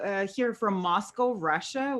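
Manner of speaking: usual speaking voice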